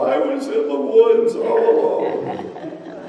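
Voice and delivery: high, whiny mock snivel; snivelling. Lost hiker whining to the innkeeper, in a high mock snivel. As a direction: whimper it like a lost child, hammed-up. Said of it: "And I snivel".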